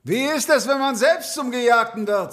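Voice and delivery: high, strained film-hero shout; Van Damme shout. Acted. Voice actor performs the showdown line for a fan, dramatic shout. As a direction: cry out the showdown line, pitched well up. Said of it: "so ganz laut, wo Van Damme schreit".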